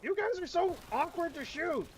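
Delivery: high-pitched frustrated whine; frustrated high cry. Real, not acted. Gamer missing shots, whining loudly.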